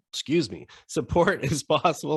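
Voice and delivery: coughing through a sip of coffee; choked up on a drink, laughing; choking on coffee. Real, not acted. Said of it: "I'm choking to death here on my coffee".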